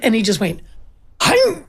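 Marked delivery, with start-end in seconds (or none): 1.2-1.6 much higher imitated bite sound; mock chomp noise. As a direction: snap out a puppy's chomp. Puppy biting shoulder.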